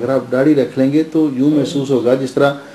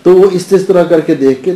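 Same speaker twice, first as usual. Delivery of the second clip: normal talking voice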